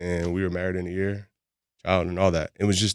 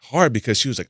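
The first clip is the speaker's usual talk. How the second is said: ordinary talk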